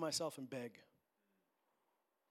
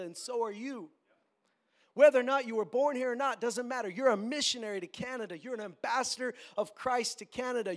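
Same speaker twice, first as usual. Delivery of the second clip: louder, emphatic sermon delivery; forceful preaching; 6 semitones higher, 8 dB louder than usual. Real, not acted. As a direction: drive each phrase home to the congregation.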